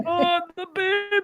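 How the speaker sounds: mock panicked granny.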